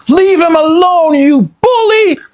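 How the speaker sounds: put-on high, loud furious girl's cry; angry cry. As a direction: scream at the pirate. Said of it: "she cried".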